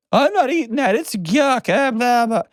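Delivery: put-on high, whiny child refusing food; mock a picky kid's high whine. Parent imitating a kid refusing dinner, high whine.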